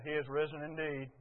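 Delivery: low, soft, unenthusiastic response; subdued automatic answer; half-asleep reply. Retold or imitated. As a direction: mumble it back with an early-morning headache. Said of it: "We're kind of asleep at the switch".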